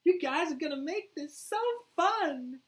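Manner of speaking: very high, loud, drawn-out delight; gleeful Harley voice. Acted. Harley delights in her new teammates, high loud glee. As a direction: squeal it slowly with glee.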